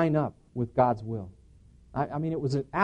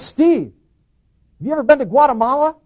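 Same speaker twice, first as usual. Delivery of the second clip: raised, high-pitched call; calling across; 10 semitones higher, 9 dB louder than usual. Retold or imitated. Brother calls from across the store, calling out. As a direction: call out loudly and quickly. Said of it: "my brother from across the store".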